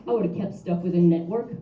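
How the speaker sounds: usual speaking voice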